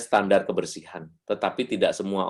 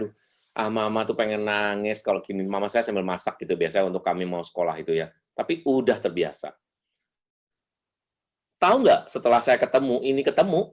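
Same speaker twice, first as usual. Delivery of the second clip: ordinary talk, nothing marked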